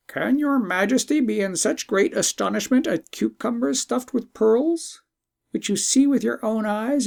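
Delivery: a wise bird gently mocking the emperor's belief; talking bird, chiding.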